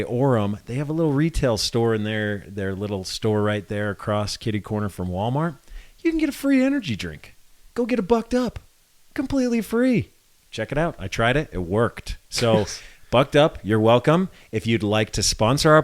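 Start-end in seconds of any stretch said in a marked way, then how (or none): 6.0-10.1 high, fast commercial voice; ad pitch. Pretend. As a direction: sell it like a TV ad. Ad-style pitch for energy drink.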